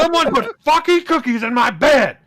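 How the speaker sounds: high, loud furious yell; outraged yell. Acted. Wrestler yelling at roommates over the cookie prank, furious. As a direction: bellow it, demanding answers. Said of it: "he yelled".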